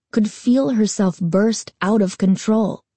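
usual speaking voice

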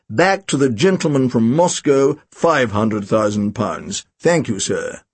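low, slow plummy voice; plummy auctioneer, pitched low and drawn out. A narrator's reading. Auctioneer greets the room over intercom, plummy and slow.

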